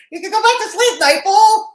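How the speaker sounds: high-pitched teasing call; playful high sing-out to a friend. Real, not acted. Teasing a viewer in chat, laughing.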